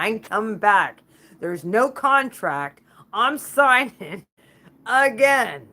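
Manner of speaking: slow booming refusal; emphatic loud declaration. Real, not acted. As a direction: hammer each word home, slow and loud.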